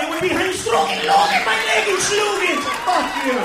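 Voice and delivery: high, very loud angry rant; furious tirade. Retold or imitated. American tourist telling off Pierre the waiter, furious shouting. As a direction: build to a screaming, mock-German outburst.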